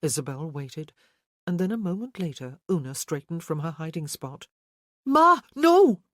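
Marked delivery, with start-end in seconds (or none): 5.0-6.0 high, loud alarmed exclamation; exclaimed. Acted. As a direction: cry out to stop his mother. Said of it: "Orly exclaimed".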